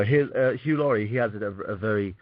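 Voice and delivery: usual speaking voice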